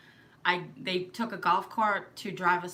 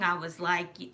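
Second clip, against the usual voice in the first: ordinary talk, nothing marked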